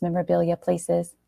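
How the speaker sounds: plain reading voice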